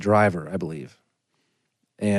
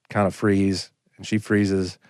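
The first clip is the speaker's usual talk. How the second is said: normal talking voice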